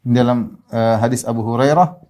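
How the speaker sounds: ordinary delivery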